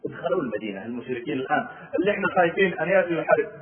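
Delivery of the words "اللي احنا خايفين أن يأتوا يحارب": ordinary talk, nothing marked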